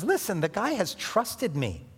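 put-on a young man protesting hotly to his master's wife; a touch of indignant Joseph; Joseph refusing firmly. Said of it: "And so Joseph says".

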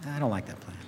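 voice of God. Pretend. God answering the proud planner, softly unimpressed. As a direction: calmly turn the plan down. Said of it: "God goes".

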